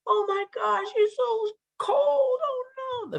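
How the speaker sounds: very high, cooing sympathy; cooing girls. Acted. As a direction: coo over a freezing bunny. Daughters coo over cold bunny.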